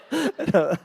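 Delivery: usual speaking voice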